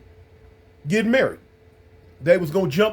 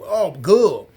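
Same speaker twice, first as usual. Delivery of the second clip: put-on Southern drop-R girl. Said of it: "they say gull".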